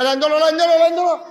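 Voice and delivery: high, loud repeated shout; calling out orders. Acted. Handing out weapons to the men, shouted orders. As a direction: call the crowd into line.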